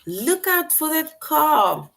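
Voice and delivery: high, slow warning call; urgent warning. Shown as a demonstration. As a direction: call it out like you see the car coming. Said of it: "For example".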